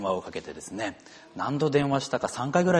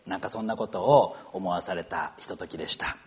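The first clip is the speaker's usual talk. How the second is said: ordinary talk, nothing marked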